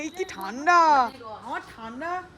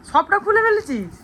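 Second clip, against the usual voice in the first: surprised scolding; 7 semitones higher, 5 dB louder than usual. Real, not acted. Mother sees child opened packet.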